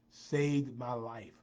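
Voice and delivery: slow, drawn-out statement at normal volume; slow emphasis. Real, not acted. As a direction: stretch each word, falling at the end. Repeats line for emphasis.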